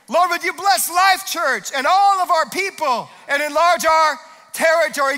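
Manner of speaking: high, loud shouted prayer; preaching prayer. Real, not acted. Pastor praying over Life Church at full force. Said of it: "pastor prays for church".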